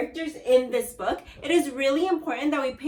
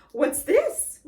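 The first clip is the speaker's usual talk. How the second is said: mild gasp; 6 semitones higher, 5 dB louder than usual. Acted. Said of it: "gasped Mister Twit".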